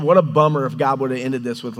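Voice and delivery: ordinary delivery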